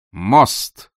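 loud excited cry; shout. A narrator's reading. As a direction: call it out loudly. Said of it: "крикнул лорд Рокстон". (громкий возглас)

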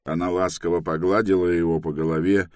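ordinary delivery, nothing marked

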